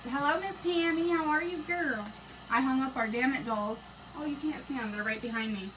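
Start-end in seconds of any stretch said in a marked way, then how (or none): 0.0-2.0 higher, cheery hello to a viewer; cheerful hello to a commenter; bright greeting. Real, not acted. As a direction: sing out the welcome. Greeting viewer in live chat.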